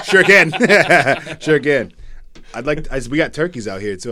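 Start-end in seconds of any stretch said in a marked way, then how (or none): 0.0-1.9 loud laughing "sure can". Real, not acted. Joking that they can edit it out, laughing.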